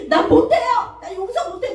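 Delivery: put-on high, loud childish wail of refusal; mock defiant refusal. Believer refusing to forgive, high whiny protest. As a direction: stamp your foot and refuse to forgive.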